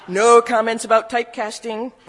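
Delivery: laughing through the words. Real, not acted. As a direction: joke it out with a laugh. Joking aside with laugh.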